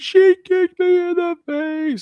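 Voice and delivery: loud, very high, drawn-out mock wail; screaming, crying child. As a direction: imitate a little kid bawling out a complaint. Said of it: "Cal screaming and crying".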